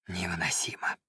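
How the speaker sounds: low, barely voiced whisper; overwhelmed whisper. A narrator's reading. Overwhelmed by the summer morning's beauty, whispering to his friend. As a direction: whisper it, as if overcome.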